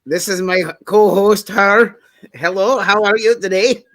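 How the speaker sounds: mild put-on Scottish lilt; Scottish accent. Host greets co-host in a playful Scottish accent. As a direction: introduce your co-host in a light mock Scottish accent. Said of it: "How did you learn to do a Scottish accent?".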